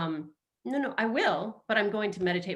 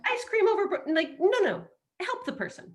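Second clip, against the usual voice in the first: high scolding; 13 semitones higher, 4 dB louder than usual. Real, not acted. Mock scolding, laughing.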